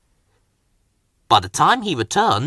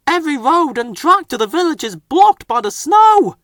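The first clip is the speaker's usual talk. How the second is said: high, louder urgent warning; shouted; 12 semitones higher, 4 dB louder than usual. A narrator's reading. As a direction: call out over the storm, urgent. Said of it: "the fogman shouted".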